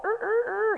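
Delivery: high mock rooster crow; imitating the rooster's crow.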